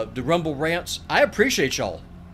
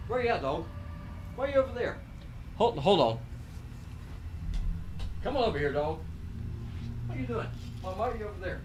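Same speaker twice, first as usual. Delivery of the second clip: coaxing the dog; 2 semitones higher, 10 dB quieter, faster than usual. Real, not acted. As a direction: gently coax a dog out of the corner. Owner calling dog mid-stream.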